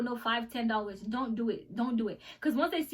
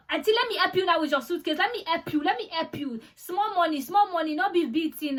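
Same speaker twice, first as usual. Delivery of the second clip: higher, louder imitation of an eager Nigerian helper; insistent wheedling, acted out; pestering airport hustler; 6 semitones higher, 5 dB louder than usual. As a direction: mimic a tout pushing to help. Retold airport helpers pestering for money.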